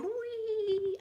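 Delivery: long, high-pitched pretend whee; high kidding-on joyride cry; playful squeal. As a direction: squeal it out like riding a roller coaster. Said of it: "and I'll be going".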